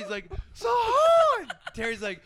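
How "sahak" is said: imitated prank yell, very high and loud; super loud scream. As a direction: scream the name across a warehouse, mimicking the prankster. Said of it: "he would do it super loud".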